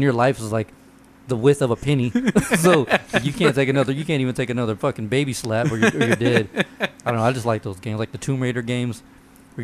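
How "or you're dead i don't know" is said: ordinary talk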